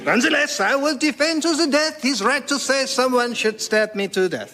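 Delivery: put-on high, heroic French accent; outrageous French accent. Voltaire offering himself first in a French accent. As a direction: declare it nobly, French style. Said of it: "in outrageous French accent".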